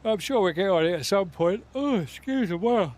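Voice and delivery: talking through a yawn; stretched, yawning words. Real, not acted.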